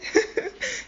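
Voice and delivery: short high laugh. Real, not acted. Storyteller amused by the grandfather act, high laugh. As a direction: break into a high giggle.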